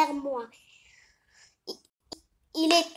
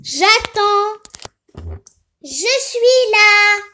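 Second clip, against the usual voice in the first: put-on lightly higher sing-song boy voice; son Niso doll voice; 5 semitones higher, 4 dB louder than usual. Son answering his mother's call, lightly higher sing-song. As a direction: play the helpful son, a bit higher.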